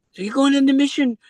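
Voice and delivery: put-on Kiwi preacher accent. Mega church preacher hailing Elizabeth from the stage, in a high Kiwi accent. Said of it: "They had this Kiwi accent".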